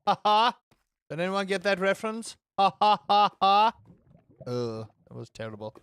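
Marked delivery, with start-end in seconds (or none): 0.1-0.6 deliberate, separated "ha ha"; loud fake laugh; mock laugh. Streamer quoting a reference to chat, mock laugh. 2.6-3.7 loud, evenly spaced fake "ha"s; mock laugh. Streamer quoting a reference to chat, mock laugh.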